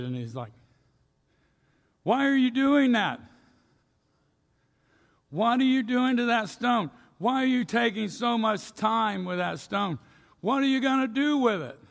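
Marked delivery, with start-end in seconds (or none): 2.0-11.8 high, loud, exasperated questions; aggravated outburst. Retold or imitated. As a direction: demand it, fed up and baffled. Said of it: "the guy just getting aggravated".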